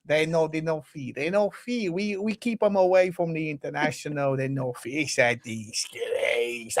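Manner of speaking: mock-tearful foreign football manager; hammed-up upset coach with accented, broken English; Mikel Arteta crying impression. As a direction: whine through a complaint, dragging out the key words. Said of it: "have you got Arteta crying?".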